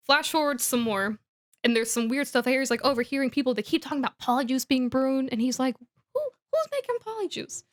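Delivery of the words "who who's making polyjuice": very high, baffled questioning; mock bewilderment. Boy overhears talk of potion brewing, imitated bewilderment. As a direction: wonder aloud in a squeaky voice.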